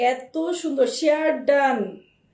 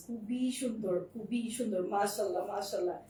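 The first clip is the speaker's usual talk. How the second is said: soft admiring murmur; 4 semitones lower, 10 dB quieter than usual. Real, not acted. Admiring dress, dropping to near whisper.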